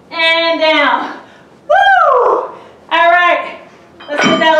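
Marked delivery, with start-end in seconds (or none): 1.7-2.5 excited whoop. Real, not acted. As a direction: let out a big happy whoop. Coach cheering mid-workout.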